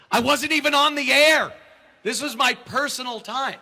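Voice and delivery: high, loud protest; protesting to his agent, loud and high; indignant shout. Retold or imitated. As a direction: raise the voice in disbelief.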